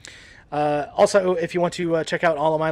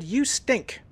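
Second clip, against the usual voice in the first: mocking review; 9 semitones higher than usual. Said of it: "review that just said".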